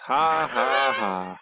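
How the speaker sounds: mock laugh in slow, separate syllables; sarcastic laugh. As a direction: fake an unimpressed "ha, ha, ha". Sarcastic reaction to incest jokes.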